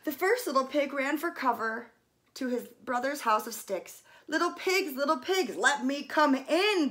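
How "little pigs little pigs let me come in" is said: higher, louder wolf call; bellowed. A narrator's reading. Wolf bellowing at the stick house door. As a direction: call out to the pigs inside. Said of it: "bellowed the wolf".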